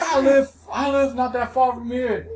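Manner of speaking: high-pitched indignant rant; imitated angry complaint. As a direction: imitate an aggrieved father pleading his case. Acted angry father's complaint in retold quarrel.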